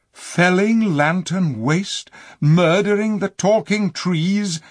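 high, loud, outraged question; outraged cry. A narrator's reading. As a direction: burst out in horror. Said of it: "cried the king".

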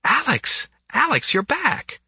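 high, bright squeal of delight; gushing. A narrator's reading. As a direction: gush over a returning regular. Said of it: "a waitress at the Nugget gushed".

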